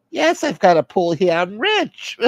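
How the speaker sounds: high-pitched mock bragging; boastful high-voiced figure. Soother boasts about pool.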